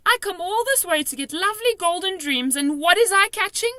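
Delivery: high, loud indignant lament; distressed complaint. A narrator's reading. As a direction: complain in rising anguish.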